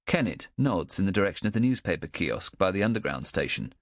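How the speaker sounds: usual speaking voice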